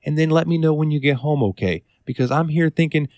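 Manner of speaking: high-pitched imitation of a concerned coworker; worried Miss Edie. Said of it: "Miss Edie came up to me today and she's like".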